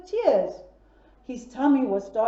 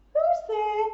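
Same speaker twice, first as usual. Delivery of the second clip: squeaky little pig asking who's there; a higher piggy voice; scared piglet answering the knock; 9 semitones higher than usual. Acted. Piggy answers the door, high.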